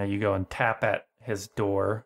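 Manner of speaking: usual speaking voice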